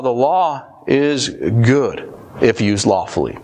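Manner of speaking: half-speed with weighty pauses; slow, deliberate. Real, not acted. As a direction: spell it out slowly for the congregation. Slow first take, then repeated.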